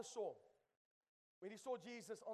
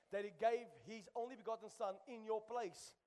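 ordinary talk

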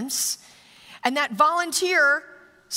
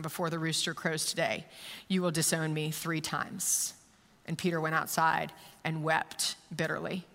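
soft and subdued, with weighty pauses; solemn scripture reading; 6 semitones lower, 5 dB quieter than usual. Acted. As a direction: read it gravely, letting each phrase sink in. Reads poignant Scripture passage.

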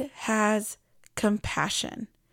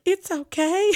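relieved; 9 semitones higher, 3 dB louder than usual. Retold or imitated. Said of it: "And I was like".

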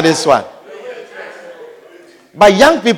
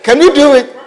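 impatient interviewer; 7 semitones higher, 6 dB louder than usual. Acted. Interviewer repeats the question.

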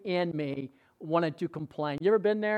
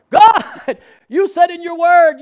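high, booming cry to God; strong, emboldened shout; 12 semitones higher, 15 dB louder, slower than usual. Retold or imitated. Preacher re-enacting marching through his room praying, emboldened shout. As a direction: shout it boldly, claiming the promise. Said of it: "I was marching through my room saying".

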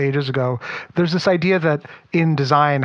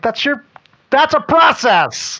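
much higher, louder, slow insistence; incredulous exclaim; 11 semitones higher, 5 dB louder than usual. Retold or imitated. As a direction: spell out the obvious, exasperated. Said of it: "I'm like".

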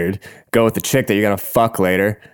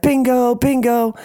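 very high, sing-out mock excitement; imitated happy bingo call; 14 semitones higher, slower than usual. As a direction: call it high like a delighted winner. Happy sister calling out bingo.

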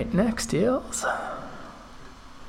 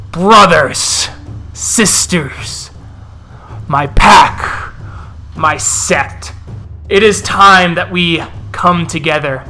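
growling wolf elder; slower than usual. Acted. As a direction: snarl it out like a wolf chief rousing his pack. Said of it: "As he growls and snaps".

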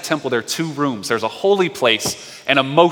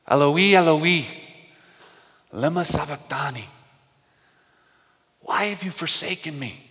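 anguished cry; 5 semitones higher than usual. Retold or imitated. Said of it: "he quotes a psalm and he cries out".

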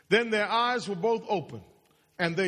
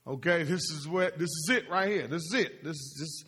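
usual speaking voice

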